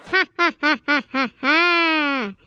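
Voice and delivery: slow, staccato theatrical "ha ha ha"; hammy film-villain cackle; villain laugh. Acted. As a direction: boom out a villain's laugh, stretching the last ha. Villain announces himself as Don.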